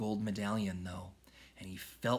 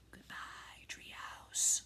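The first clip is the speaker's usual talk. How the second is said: soft breathy whisper; 7 dB quieter, much slower than usual. A narrator's reading. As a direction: whisper a farewell. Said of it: "whispered Annie".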